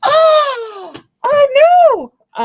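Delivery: high, loud cry of dismay; a sudden squeal at a bad card; shocked cry. Real, not acted. Reader shrieking at the card just drawn.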